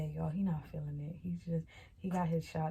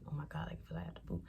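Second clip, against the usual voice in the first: hushed complaint; 9 dB quieter, much faster than usual. Retold or imitated. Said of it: "So I'm just like".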